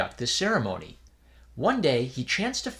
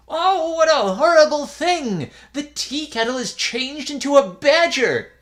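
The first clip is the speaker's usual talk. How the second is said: much higher, loud horrified cry; frightened cry; 8 dB louder than usual. Acted. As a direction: cry out in horror at the badger. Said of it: "He cried out".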